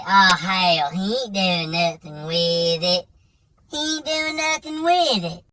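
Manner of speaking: [name]'s drawl. Retold or imitated. Buddy brushing off the warning, slow mock drawl. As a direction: drag it out like a lazy good old boy.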